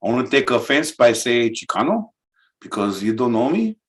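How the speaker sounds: laid-back SoCal Chicano voice, offended; Chicano accent. Acted. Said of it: "Chicano of SoCal".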